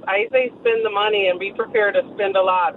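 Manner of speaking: normal talking voice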